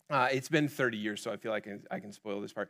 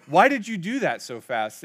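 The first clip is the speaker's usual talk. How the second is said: louder demanding sergeant; drill sergeant demanding; 6 semitones higher, 11 dB louder than usual. Retold or imitated. Drill sergeant demanding an answer from Forrest. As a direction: get in his face asking.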